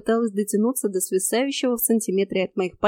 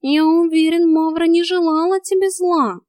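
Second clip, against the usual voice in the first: higher, drawn-out careful reassurance; tactful, raised character voice; cautious cat; 7 semitones higher, 4 dB louder than usual. A narrator's reading. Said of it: "осторожно проговорил кот".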